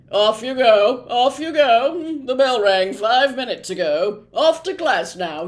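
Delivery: officious prefect dispersing a crowd; shooing prefect. A narrator's reading. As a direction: shoo the kids away officiously. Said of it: "he said, shooing some of the younger students away".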